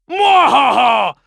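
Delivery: slow theatrical villain menace. Retold or imitated. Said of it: "I'd expect a boss or something, and he'd be like".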